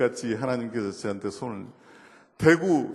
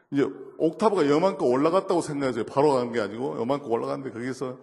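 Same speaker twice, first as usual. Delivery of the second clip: ordinary delivery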